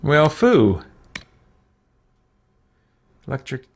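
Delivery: ordinary delivery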